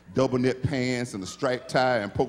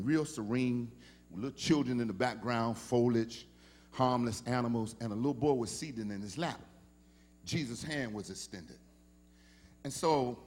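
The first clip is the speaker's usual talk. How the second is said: soft story narration; 2 semitones lower, 4 dB quieter than usual. Acted. Tells the painting story softly.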